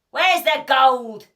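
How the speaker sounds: loud, slow, demanding shout; angry shout. A narrator's reading. Pirate chief to the troll in the chest, loud angry shout. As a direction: bellow at the troll for the gold. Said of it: "shouted Hank Chief".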